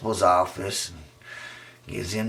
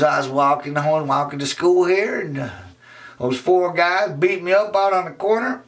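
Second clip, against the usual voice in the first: put-on high upset boy; 8 semitones higher than usual. Raymond telling Emily.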